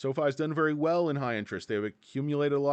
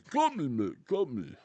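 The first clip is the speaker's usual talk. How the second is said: Sylvester Stallone. Retold or imitated. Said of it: "my Sylvester Stallone impression".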